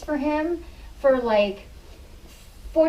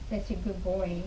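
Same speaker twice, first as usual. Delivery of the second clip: soft praise; 2 semitones lower, 8 dB quieter than usual. Real, not acted. Softly praising the dog.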